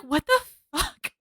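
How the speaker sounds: soft, slow, stunned disbelief; stunned dad. Retold or imitated. Dad hearing his son insult him, hushed disbelief. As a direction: let it fall out in hushed shock. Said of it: "And his dad's like".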